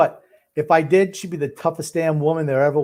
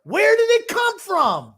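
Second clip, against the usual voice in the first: shouting; 6 dB louder, slower than usual. Real, not acted. Yells at the broadcast.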